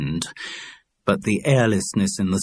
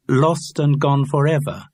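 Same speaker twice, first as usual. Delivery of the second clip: "Lost and gone forever" higher, with a tune; "Lost and gone forever" tried over, falling at the end; intoned repeat; 6 semitones higher, 3 dB louder than usual. A narrator's reading. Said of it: "What kind of intonation?".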